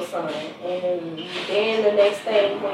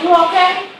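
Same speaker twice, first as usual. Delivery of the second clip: worried; 9 semitones higher, 6 dB louder than usual. Retold or imitated. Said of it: "I'm like".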